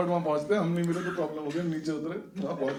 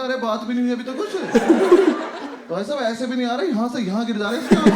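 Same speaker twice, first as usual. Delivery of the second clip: louder and higher, laughing exasperation; exasperated loud scolding; 7 semitones higher, 10 dB louder than usual. Retold or imitated. Narrator loudly scolding his crying friend before the warden, laughing exasperation.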